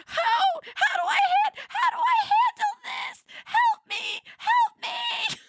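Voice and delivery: very high, loud squealing shriek; panicked cry for help. Retold or imitated. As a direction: wail helplessly, far up high, on the edge of laughter. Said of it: "banging the floor, and I'm like".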